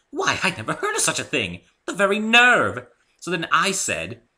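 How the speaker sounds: high and loud, scandalised; outraged lady retelling an insult; indignant Rarity gossip. A narrator's reading. Rarity gossiping to Dave about dyed feathers, indignant and loud.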